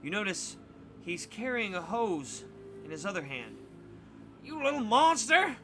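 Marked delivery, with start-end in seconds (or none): 4.4-5.6 put-on high, loud angry old-man snarl; old man snarling at Donnie. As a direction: snarl at the boy. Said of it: "he snarls at Donnie".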